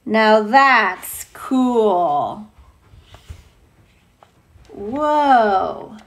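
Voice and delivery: very slow, drawn-out approval; slow admiring awe. Real, not acted. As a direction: stretch it out in admiration. Admiring reaction.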